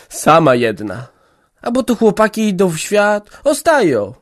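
put-on old mother pressing hard, loud and high; scold, then plead for her only daughter. A narrator's reading. (matka karci i błaga córkę)

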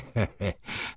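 low, soft gloating chuckle; malicious chuckle. A narrator's reading. Rzecki picturing his rival's face, malicious chuckle. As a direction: chuckle quietly to yourself in spite. Gloating over revenge on Szlangbaum.

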